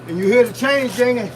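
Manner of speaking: usual speaking voice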